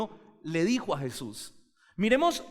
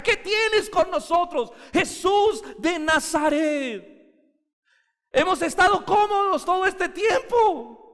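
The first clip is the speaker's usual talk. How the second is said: loud, high, slowly dragged demon voice; preacher voicing the demon enraged by Jesus; demonic manifestation; 9 semitones higher, 4 dB louder than usual. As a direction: cry out like a disturbed demon. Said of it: "hubo una manifestación demoníaca".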